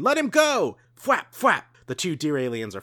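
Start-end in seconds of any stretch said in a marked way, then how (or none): 0.0-0.8 high, loud desperate yell. Acted. Kid yells at the alien holding his father, loud and high. 1.0-1.6 high, punchy tail-slap noise; thwap sound effect. Acted. Reader voices the alien tails clashing, loud punchy sound effect.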